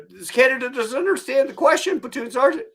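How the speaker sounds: high, loud recruit reporting formally; mock nervous candidate. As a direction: imitate a recruit answering at attention. Recruit answers the sergeant formally.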